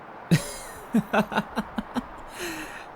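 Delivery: high unvoiced chuckle, all breath; airy self-amused chuckle; breathy laugh. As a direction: laugh under the breath at your own joke. Vampire mocks own compassion.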